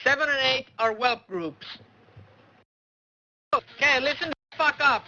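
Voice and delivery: ordinary talk, nothing marked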